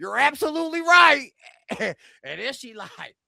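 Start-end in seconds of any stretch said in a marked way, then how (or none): 0.0-1.3 higher, louder mock agreement. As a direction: imitate an impressed yes-man, voice raised.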